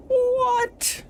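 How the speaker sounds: very high squeak of disbelief; shocked cry. Real, not acted. Player yelps at the game's twist, shocked. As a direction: yelp it in astonishment.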